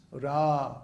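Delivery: "Ra" with a long open "aa"; held two beats. Shown as a demonstration. Teacher showing syllable length, "Ra" held long. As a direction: hold "Ra" for two full beats. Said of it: "it's held for two beats".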